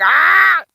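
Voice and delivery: high, loud imitated kid's scream; mock freak-out scream. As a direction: scream like a kid losing it. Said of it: "my kids lose their minds".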